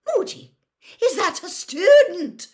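very high, loud, horrified shriek. A narrator's reading. McGonagall realises the ferret is a student, shrieking. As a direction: shriek the question in horror. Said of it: "shrieked Professor McGonagall".

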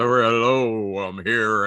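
low radio announcer impression; mock a co-host's low radio voice. Host envies co-host's voice, mock radio voice. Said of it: "He's got the best radio voice".

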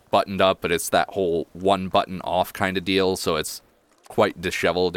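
ordinary talk

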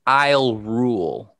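slow quoted "I'll rule"; drawn-out imitation of the lyric; quoting the singer. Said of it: "she says".

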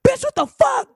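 high, loud disbelieving yell; incredulous shout. Retold or imitated. Publicist yelling at her star in shock. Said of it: "Her rep was like".

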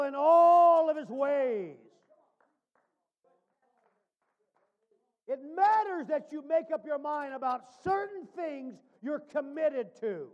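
loud, rising pulpit preaching. Acted. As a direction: preach it out to the pews. Preaching to the congregation.

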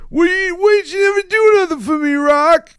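very high, loud mock yell; hammed-up screeched complaint; Paulie impression. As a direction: imitate a whining brother-in-law at full volume. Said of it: "he's like".

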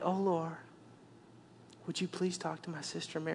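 a hushed, too-sweet request; meek polite reading. Shown as a demonstration. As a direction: read it like a polite little prayer. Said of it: "We read like".

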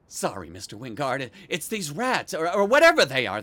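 usual speaking voice

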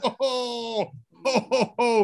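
ho ho laugh. Acted. Hosts' hammy greeting laugh.

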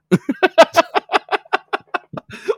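squealing high laugh. Real, not acted. Burst of laughter.

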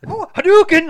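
high, loud fighting-game yell; video game shout. Retold or imitated. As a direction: shout it like a special move. Retold video game punch.

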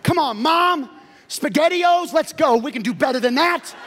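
loud, high hype shouting; kid coaching mom. Retold or imitated. Child yelling at mom about SpaghettiOs, loud hype. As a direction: cheer her on like a sideline coach. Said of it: "kids started talking to us like that".

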